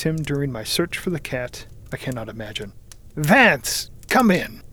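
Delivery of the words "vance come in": raised-voice summons; crying out. A narrator's reading. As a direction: call someone in from the next room. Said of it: "cried Mister Wilde".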